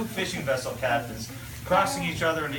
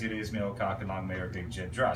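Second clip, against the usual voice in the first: Newfoundland dialect; 8 semitones lower, 8 dB quieter than usual. Acted. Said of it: "only one proper response for that".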